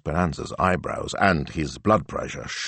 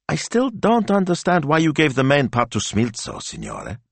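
high-pitched complaint, dropping at the end; puzzled young assistant, frowning; 9 semitones higher than usual. Acted. Assistant questions her boss's casting, puzzled.

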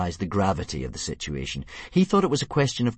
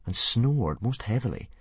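ordinary delivery

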